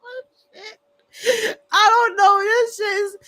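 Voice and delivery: high, teary laughing; gasping through tears of laughter; real tears of laughter; crying with laughter. Real, not acted. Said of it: "Okay, I'm in tears".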